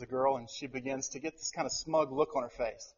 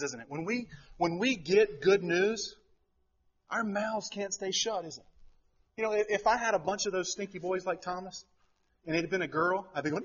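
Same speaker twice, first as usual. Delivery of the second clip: usual speaking voice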